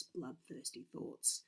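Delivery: plain reading voice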